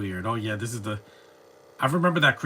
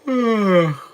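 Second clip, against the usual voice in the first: exaggerated sigh; 9 semitones higher, 8 dB louder than usual. Loud sigh.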